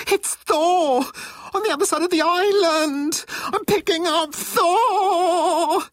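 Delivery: high squealing fangirl voice; screaming crying fan. A narrator's reading. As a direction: shriek like a teen fan. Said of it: "suddenly sounding like a teenage fangirl".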